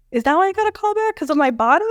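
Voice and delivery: high, quick mock-indignant question; incredulous. Young actress challenging the director about the callback, incredulous.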